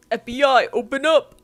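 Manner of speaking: loud, commanding raid call; mock police shout. Reader calling out Edward over children, mock FBI shout. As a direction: shout it as a pretend agent at the door.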